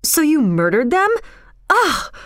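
high-pitched disgusted outcry; appalled young man; shocked exclamation. A narrator's reading. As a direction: exclaim in horror, leaping up on the last sound. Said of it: "exclaimed John".

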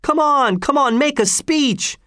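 very high, urging calls; high-pitched clamoring; crowd shout. A narrator's reading. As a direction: egg him on like an eager crowd. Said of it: "shouted the crowd".

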